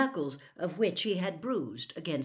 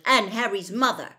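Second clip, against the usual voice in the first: raised, angry add-on; angry protest, a bit louder; snarled retort; 4 semitones higher, 8 dB louder than usual. A narrator's reading. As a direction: snarl it in self-defence. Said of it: "snarled George".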